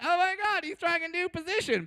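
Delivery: high, squealing excited woman; freaking-out woman. Acted. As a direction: squeal it like a thrilled girlfriend. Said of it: "the women start freaking out, like".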